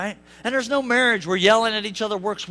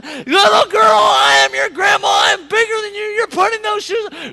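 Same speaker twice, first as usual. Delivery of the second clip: furious grandma shout; 10 semitones higher, 12 dB louder than usual. Retold or imitated. Grandma shouting at stubborn granddaughter over shoes, furious yell. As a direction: yell it loud and high, losing your temper. Grandma yelling at four-year-old.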